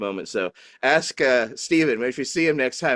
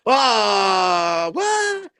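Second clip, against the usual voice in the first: much higher, louder puzzled reaction; the baffled audience turning around; confused crowd; 11 semitones higher, 4 dB louder than usual. Retold or imitated. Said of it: "They're all just like".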